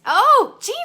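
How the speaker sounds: loud, high delighted "Oh"; sudden high burst of excitement; excited greeting. Real, not acted. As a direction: call out in happy surprise. Excitedly greets participant joining in.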